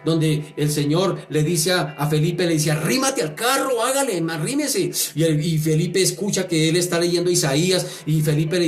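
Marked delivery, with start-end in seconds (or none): 2.7-4.9 urging command. Retold or imitated. The Lord urging Philip.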